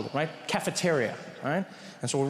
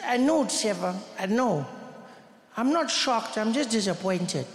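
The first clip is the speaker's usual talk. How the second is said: higher-pitched, disappointed schoolboy; Thiessen; 4 semitones higher, slower than usual. Retold or imitated. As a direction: play the weary friend, pitched up and let down. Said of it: "And he said".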